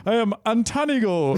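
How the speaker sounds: mock critic with an American accent. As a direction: announce yourself grandly in an American accent. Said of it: "In an American accent".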